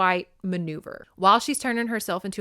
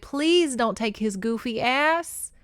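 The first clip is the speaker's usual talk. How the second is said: mock pleading; 7 semitones higher than usual. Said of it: "I said".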